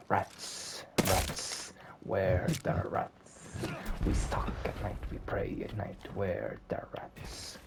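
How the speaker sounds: eerie whispered rat chant. Acted. Rat chants to itself in a creepy whisper. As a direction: hiss it like creeping rats. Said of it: "the rat starts saying".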